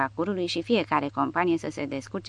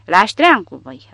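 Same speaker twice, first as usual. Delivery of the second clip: much louder, slowed raging roar; officer screaming, out of his mind; 3 semitones higher, 10 dB louder, slower than usual. A narrator's reading. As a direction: lose your mind and bellow it. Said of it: "răcni ofițerul complet scos din minți".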